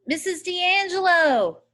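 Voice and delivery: loud, high call across the water; hollering at the top of lungs. A narrator's reading. Boy searching the creek, hollering for the missing woman. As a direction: shout her name out into the creek. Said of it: "I hollered at the top of my lungs".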